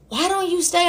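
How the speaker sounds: high, light therapist voice; imitated therapist. Therapist suggesting she stay, high imitated voice. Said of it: "I heard my therapist be like".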